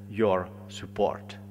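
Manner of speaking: usual speaking voice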